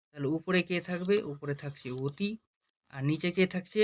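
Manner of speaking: normal talking voice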